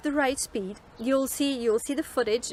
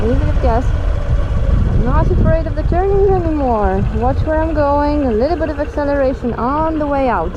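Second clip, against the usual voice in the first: muffled voice in a helmet; talking inside a helmet on the bike; inside a motorcycle helmet; 11 dB louder than usual. Real, not acted. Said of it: "You can hear me in, in the helmet".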